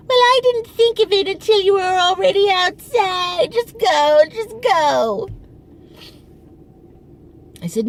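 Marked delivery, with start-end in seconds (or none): 0.1-5.3 much higher, louder mock mother brushing her off; dismissive mother. Daughter imitating her mother sending her off, much higher. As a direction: shoo her away as the mother.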